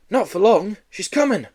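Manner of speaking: high, loud warning cry; urgent cry. Acted. Pupil warning the class, high urgent cry. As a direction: call out the alarm. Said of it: "cried Harry Skinner".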